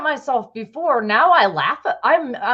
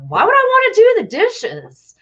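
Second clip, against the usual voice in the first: higher, louder incredulous whine; exasperated boyfriend; 9 semitones higher, 4 dB louder than usual. Retold or imitated. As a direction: throw the question back in disbelief. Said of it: "he was like".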